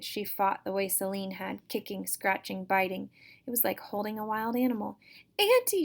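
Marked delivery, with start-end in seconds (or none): 5.4-5.8 high, loud desperate scream. Acted. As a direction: shriek for Auntie with all her might. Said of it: "she screamed".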